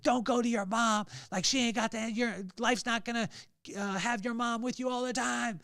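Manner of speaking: angry dad. Retold or imitated. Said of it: "I'm getting angrier and angrier".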